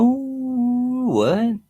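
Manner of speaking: high, rising baffled what; shocked disbelief at the guess; surprised what. Real, not acted. Streamer reacting to a chatter's odd car guess, surprised.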